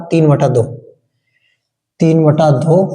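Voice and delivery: ordinary talk, nothing marked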